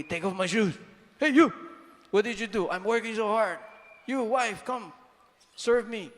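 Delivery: a hint of a grumpy father barking orders; bossy grumpy father. Preacher imitates a hungry father snapping at his family, mild mock anger. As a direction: lightly play a tired dad demanding service. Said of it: "the father comes in".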